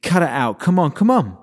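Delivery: loud pleading shout at a rampaging unicorn. A narrator's reading. Scarlet shouting at the raging unicorn to stop. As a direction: yell at him to stop, loud and urgent. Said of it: "Scarlet shouted at him".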